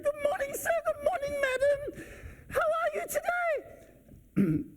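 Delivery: very high, ringing doorman greeting; over-feminine doorman impression. Doorman greeting guests across the lobby, high-pitched mimicry. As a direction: sing out a greeting in falsetto. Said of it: "A little bit more masculine than that".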